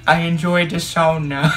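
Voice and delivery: badly faked Finnish, evenly paced; Finnish accent. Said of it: "That's supposed to be Finnish".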